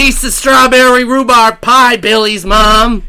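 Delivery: high, very loud screaming demand. Acted. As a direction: scream it out in full rage.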